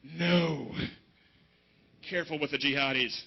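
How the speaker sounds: imitated worried audience member; wary front-row dude. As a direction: warn him off, low and drawn-out. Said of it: "this dude right in the front row, he's like".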